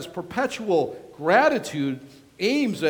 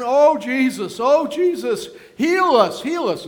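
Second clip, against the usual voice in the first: desperate plea; 7 semitones higher, 8 dB louder than usual. Acted. Lepers begging Jesus.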